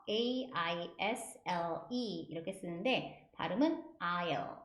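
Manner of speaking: "aisle" said like "I'll", no S sound; silent S in AISLE. Shown as a demonstration. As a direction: model "aisle" slowly with the S silent. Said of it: "A와 S가 묵음이라고". ("aisle"의 S는 소리 없이 '아일'처럼)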